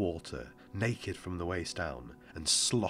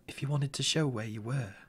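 put-on small, higher child voice; boy's voice; 8 semitones higher than usual. A narrator's reading. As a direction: voice the boy, light and young.

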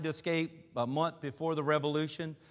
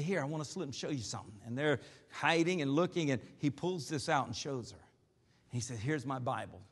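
secretive; 4 semitones lower, 8 dB quieter than usual. Retold or imitated. Hiding, secretly showing the Bible.

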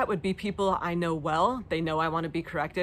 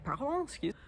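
confused pardon; 9 semitones higher, 5 dB quieter than usual. Retold or imitated. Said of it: "I was like".

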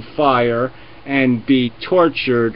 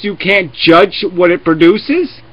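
ordinary talk

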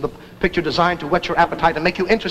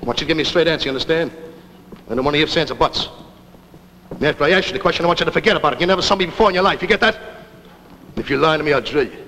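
tough-guy gangster with menacing pauses; movie gangster; 3 semitones lower than usual. Acted. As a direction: play a hammed-up film hoodlum giving orders. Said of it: "this gangster coming attraction looked to me".

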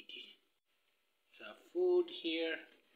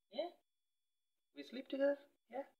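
much higher, rising question to the cat; high cooing question; 13 semitones higher, much faster than usual. Real, not acted. Cooing to her cat, much higher rising question. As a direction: lift it way up as if asking the kitty.

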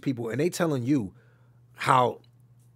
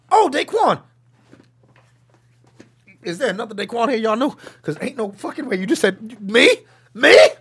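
excited retold call-out; 8 semitones higher, 9 dB louder than usual. Retells voice actors calling his name.

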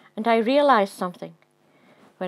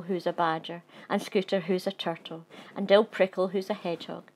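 ordinary delivery

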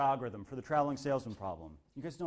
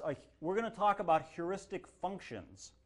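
ordinary delivery

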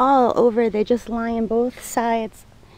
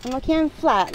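ordinary talk

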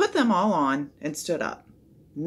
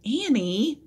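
higher, drawn-out cautioning name; warning; 7 semitones higher, 3 dB louder than usual. A narrator's reading. Jack cautions his sister, warning. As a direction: warn her with just her name. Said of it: "warned Jack".

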